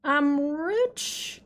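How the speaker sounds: loud, boastful money brag; crowing like a rich show-off; gloating. Pretend. Gloating over game money.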